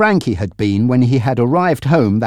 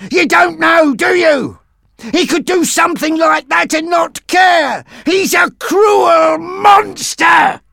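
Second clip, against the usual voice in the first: very high, loud hysterical shouting; anguished, high-pitched raging yell; screaming; 5 dB louder than usual. A narrator's reading. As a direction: keep screaming each accusation at full force. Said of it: "Sidney was still screaming".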